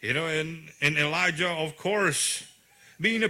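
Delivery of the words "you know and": ordinary talk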